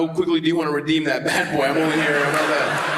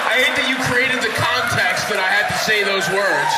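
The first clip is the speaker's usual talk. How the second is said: exasperated shout; 5 semitones higher than usual. Acted. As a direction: yell it in fake protest. Mock outrage at crowd.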